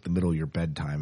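ordinary talk, nothing marked

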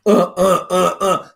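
short demonstrative grunts; imitated effort grunts. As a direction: grunt repeatedly as if shoving a machine. Imitates pushing the machine.

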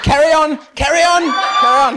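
loud, high urging; urgent encouragement. Retold or imitated. Minister to sobbing girl, loud high encouragement. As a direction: call it out over the crying. Retold urging over wailing.

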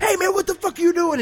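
high, loud alarmed yell; mock shout. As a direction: yell it up high at your partner. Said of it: "Murtaugh runs over and he's like".

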